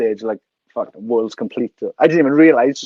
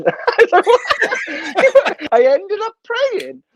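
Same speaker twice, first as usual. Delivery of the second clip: high-pitched, laughing through the words; laughing hard. Real, not acted. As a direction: crack up while telling it. Cracks up at his own punchline.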